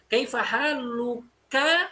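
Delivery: kaifa haluka drawn out slowly, final a sounded; slow teaching read of haluka; slow connected form. Shown as a demonstration. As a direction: stretch haluka and keep its last vowel. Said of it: "Beda kalau kalian wasolkan".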